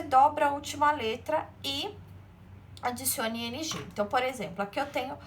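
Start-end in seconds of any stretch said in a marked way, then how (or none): none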